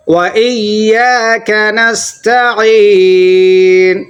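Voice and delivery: slow chanted وَإِيَّاكَ نَسْتَعِينُ; Quran recitation. Shown as a demonstration. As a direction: draw out the long ي in وَإِيَّاكَ.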